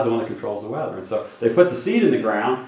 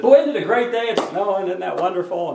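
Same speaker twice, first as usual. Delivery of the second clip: high, bright-eyed fake enthusiasm; mock cheerful preacher; 8 semitones higher, 3 dB louder than usual. Preacher pretending to love the snow, hammed-up high cheer. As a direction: gush about the snow like it's great news. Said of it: "if I came in the room today and said".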